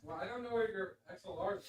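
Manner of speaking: distant, off-mic voice; calling from far off; from another room. Real, not acted. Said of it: "You're not on mic".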